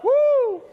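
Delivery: loud high-pitched woo; high whoop. Real, not acted. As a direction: whoop like a fan at a show. Answering crowd cheer.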